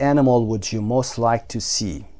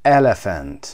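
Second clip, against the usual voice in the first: Elephant with a loud EL, falling away; first-syllable stress; 2 semitones lower, 5 dB louder, much slower than usual. Shown as a demonstration. Teacher marking the stressed syllable for learners, loud EL in Elephant. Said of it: "قسمت اول".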